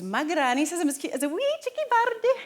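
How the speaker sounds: little child's acting line in high, lilting Scottish; Scottish accent. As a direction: recite it in a hammed-up Scottish accent. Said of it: "Can you do a Scottish accent?".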